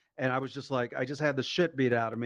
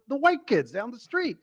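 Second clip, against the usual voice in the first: very high-pitched mock tattling; insistent excuse; 10 semitones higher, 4 dB louder than usual. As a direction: pin the blame in a high plea. Son repeats his excuse, acted out.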